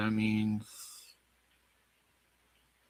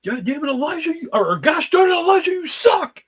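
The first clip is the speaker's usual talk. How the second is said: loud frustrated outburst; angry yelling; 7 dB louder than usual. Real, not acted. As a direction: yell it at your buddy, fed up.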